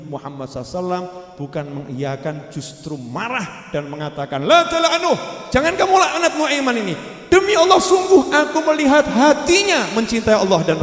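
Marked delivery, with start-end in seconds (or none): 4.4-10.8 higher, louder stern rebuke. Retold or imitated. Prophet scolding the companion for cursing, loud stern rebuke. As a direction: forbid it firmly, raising the voice.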